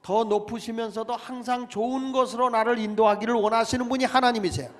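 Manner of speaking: loud, ringing sermon delivery; preaching. Acted. Pastor exhorting his congregation, preaching at full force. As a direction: preach it out to the whole church.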